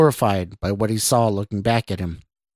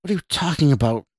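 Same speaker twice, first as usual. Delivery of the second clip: fast, higher-pitched demand; quick, irritated retort; snapping; 5 semitones higher, faster than usual. A narrator's reading. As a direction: snap the question back quickly. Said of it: "Scott snapped".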